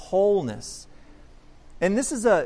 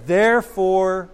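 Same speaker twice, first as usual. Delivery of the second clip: slow, louder therefore; emphatic therefore; 7 dB louder, slower than usual. Acted. Preacher reading Peter's call to repent, slow and emphatic. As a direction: land the word like a verdict.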